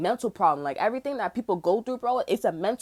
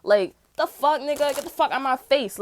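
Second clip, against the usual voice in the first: angry outburst; 6 semitones higher, 4 dB louder than usual. Real, not acted. Angry rant at them.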